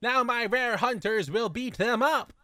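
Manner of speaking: hammy high villain gloat; mock villain. Villain gloating to his hunters, hammed-up. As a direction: gloat like a cartoon villain, slowly.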